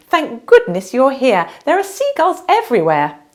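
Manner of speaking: high, loud, fussing royal voice; flustered queen. Acted. The Queen frets to Minna about seagulls, high and flustered.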